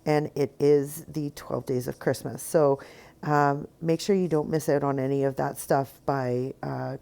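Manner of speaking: normal talking voice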